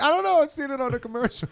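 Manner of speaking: high-pitched mock commercial voice; commercial character imitation. Comic mimicking a language commercial, high put-on voice. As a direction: squeak it out like an ad character. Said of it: "that thing from the Muzzy commercial".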